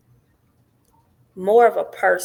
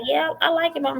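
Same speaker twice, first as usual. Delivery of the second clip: higher, light imitation of a teen daughter; daughter's voice; 11 semitones higher than usual. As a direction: lift into your daughter's eager reply. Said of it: "She was like".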